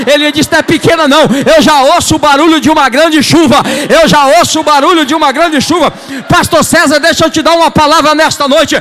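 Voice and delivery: high, loud revival preaching, building to a shout; full-force preaching. Real, not acted. Preacher telling Elijah's rain story to the church, full-force preaching. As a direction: drive each phrase at the congregation.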